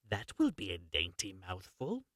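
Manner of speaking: low, soft witch mutter to herself. Acted. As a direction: savour it under your breath. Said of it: "she muttered to herself".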